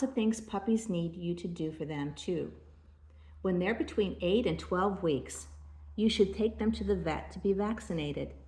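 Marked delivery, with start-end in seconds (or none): none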